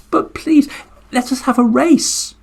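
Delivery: high, small turtle voice asking; little turtle's plea. Acted. Turtle proposing a race to the Garuda bird, high and coaxing. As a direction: coax the great bird into a race. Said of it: "said the turtle".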